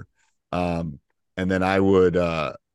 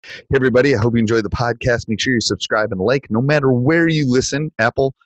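podcast outro read; 5 semitones higher, 6 dB louder than usual. Acted.

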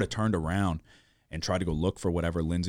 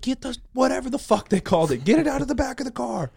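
much higher, louder frantic yell; family yelling to grab the block, high and loud; retold panicked yelling; 6 dB louder than usual. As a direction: yell it like everyone is losing it. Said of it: "'cause they're still yelling".

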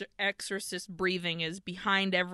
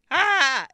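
short, loud, higher-pitched yelp; mock scream; 5 semitones higher, 8 dB louder than usual. Shown as a demonstration. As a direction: demo of a simple scream. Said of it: "it isn't just like".

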